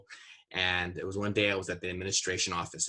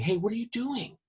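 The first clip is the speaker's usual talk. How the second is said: calling out; 14 semitones higher than usual. Retold or imitated. Confronting a tagger across street.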